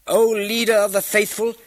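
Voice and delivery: high, loud public address; bold loud address. A narrator's reading. Young accuser addresses the caliph before the crowd, bold loud call.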